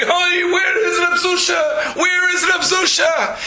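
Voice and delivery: high mock cry of grief, slow. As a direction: students calling out for the lost rabbi, hammed-up. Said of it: "begin to cry and say".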